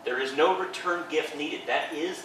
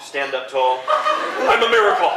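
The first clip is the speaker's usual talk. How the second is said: proud declaration; 7 dB louder than usual. Shown as a demonstration. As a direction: declare it to yourself like a triumph. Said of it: "And I tell my students".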